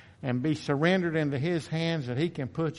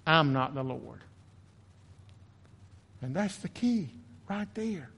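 normal talking voice

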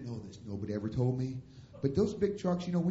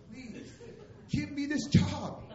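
high, slow, hammed-up plea; begging; 11 semitones higher, slower than usual. Preacher begging pastor for ten dollars an hour, pleading. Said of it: "I'm begging".

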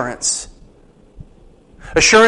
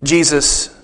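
ordinary delivery

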